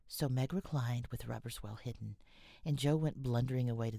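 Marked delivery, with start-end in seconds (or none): none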